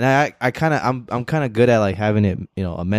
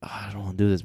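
reluctant; 8 dB quieter, much faster than usual. Acted. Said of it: "when I'm just like".

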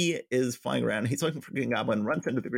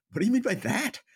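high, indignant question; mock offence; 12 semitones higher than usual. Retelling a secretary answering Peter's dig, mock offence. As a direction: imitate an affronted demand, pitched way up. Offended retort in retold conversation.

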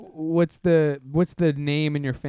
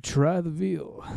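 sultry impression; 5 dB quieter, much slower than usual.

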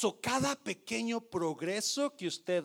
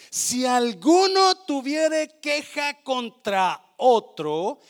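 slow scripture reading; 5 semitones higher, 10 dB louder, slower than usual. Acted. Reading verse.

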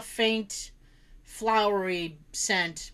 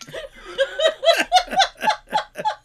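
big shrieking laugh; loud high laughter; 7 dB louder than usual. Real, not acted. Sister's joke.

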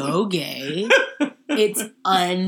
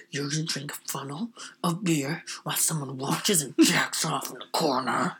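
whisper; 3 semitones lower, 5 dB quieter than usual. Retold or imitated. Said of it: "it's like".

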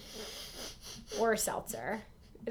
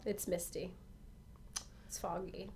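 hushed scene-setting; 4 semitones lower, 17 dB quieter than usual. Pretend. Playing up the spooky scene.

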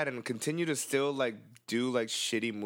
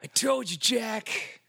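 villain taunt; 11 semitones higher, 4 dB louder, much slower than usual. Retold or imitated. Quoting Speed villain.

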